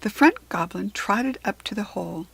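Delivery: plain reading voice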